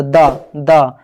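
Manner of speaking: "दा" said slowly, twice, tongue against the teeth; slow it down so pupils hear the sound; slow sound demo. Shown as a demonstration. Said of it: "तो दा बता रहा है". ("दा" को धीरे-धीरे, दो बार, दाँत पर जीभ लगाकर बोलें)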